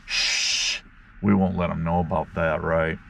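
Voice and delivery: whispered shh to keep a secret; mock secretive shush. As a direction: hush them conspiratorially. Secret about bear trap.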